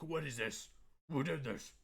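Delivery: put-on indignant demand; mock mad. Streamer showing off a shady look, mock mad. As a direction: play really mad, lightly hammed-up. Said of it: "if I'm really mad".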